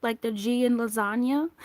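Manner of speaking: normal talking voice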